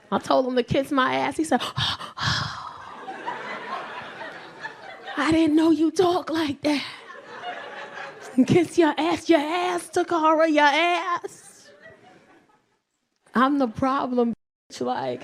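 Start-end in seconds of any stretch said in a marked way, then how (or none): 1.7-4.4 long, hammed-up gasps; shocked gasp. Boyfriend told to kiss her ass, mock-shocked gasp. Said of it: "He was heartbroken". 5.2-11.7 higher, wounded disbelief; mock heartbroken. Southern boyfriend hurt by girlfriend's insult, mock heartbroken. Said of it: "He was heartbroken".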